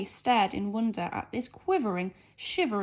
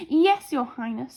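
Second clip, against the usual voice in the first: proud; 2 semitones higher, 7 dB louder than usual. A narrator's reading. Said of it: "very proudly".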